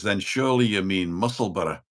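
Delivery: imitated Scottish local correcting a visitor; Scottish accent. Local correcting father on the town's name, Scottish accent. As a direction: put on a Scottish accent, "Musselburgh" ending in "burra". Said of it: "you do a good Scottish accent".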